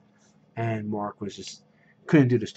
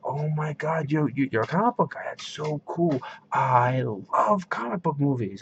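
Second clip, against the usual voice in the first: mock-gushing, higher-pitched enthusiasm; gushing fan; 6 semitones higher than usual. Movie fan meeting a comic book guy, gushing. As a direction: imitate an overexcited fan, stretching out the love.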